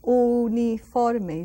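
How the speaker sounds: uniforme, slow, U said as a pure oo; Spanish U like oo. Shown as a demonstration. Teacher showing the Spanish U: uniforme. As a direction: draw out uniforme with an oo sound. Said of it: "almost like double O pronunciation".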